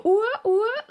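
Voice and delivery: very high, loud ooh ah cheer. Retold or imitated. Mom cheering toddler's potty success, very high and loud. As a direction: whoop it up way up high for a potty win. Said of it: "I dance and say".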